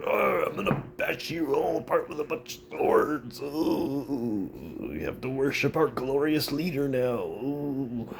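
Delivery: high mock warlord voice; pompous conqueror.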